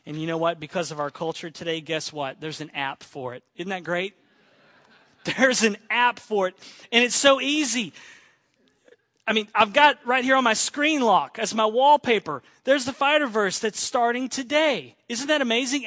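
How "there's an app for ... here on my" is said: louder, amused enthusiasm; laugh, then exclaim it with delight. Real, not acted. Delighted after laughing about the app.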